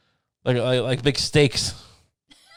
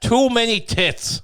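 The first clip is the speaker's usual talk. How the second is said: slow, higher-pitched mock critic; mock critic's verdict; 7 semitones higher, slower than usual. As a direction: drag out a hammed-up disapproving verdict. Mock quote of film critic.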